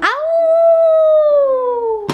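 long, high, loud wolf howl. Pretend. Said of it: "Tu fais le loup?".

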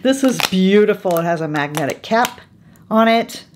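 ordinary talk

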